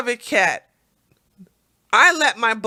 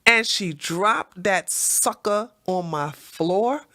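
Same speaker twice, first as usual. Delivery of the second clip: usual speaking voice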